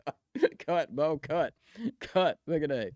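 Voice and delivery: ordinary talk, nothing marked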